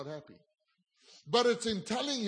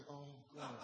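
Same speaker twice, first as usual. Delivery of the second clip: a much softer embarrassed groan of dismay with a laugh; dismayed mutter; 4 semitones lower, 18 dB quieter than usual. Retold or imitated. Pastor retelling his dread at the table, soft laughing groan. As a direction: sink into it, dreading what comes next.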